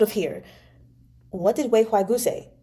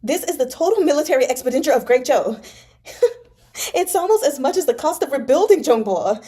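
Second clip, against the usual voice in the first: high, loud, fast ranting disbelief; loud, pressed panic over moving the silver; grim agitation; 7 semitones higher, 4 dB louder than usual. Acted. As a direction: rant at the impossible sum, half laughing. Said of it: "He began to laugh grimly".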